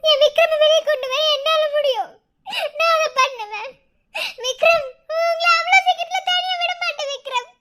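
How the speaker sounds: anguished, determined cry; tearful vow. Acted. Wife vowing to bring her husband out, tearfully determined. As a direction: vow through rising tears.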